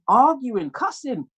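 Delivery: ordinary talk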